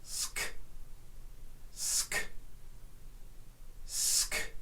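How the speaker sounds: "Sk-" as a quiet hissed s into a k click; sk sound. Shown as a demonstration. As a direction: breathe out "Sk-" with no vowel. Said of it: "Let's give it a try".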